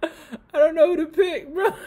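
high, loud laughing cry; words squeezed out through laughter; laughing to tears. Real, not acted. Trying to make a pick while crying with laughter.

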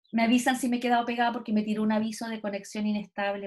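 normal talking voice

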